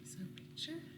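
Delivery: soft whispered aside. Real, not acted. Parent whispering to child over the picture book. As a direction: hush it, just breath.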